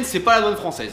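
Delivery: ordinary talk, nothing marked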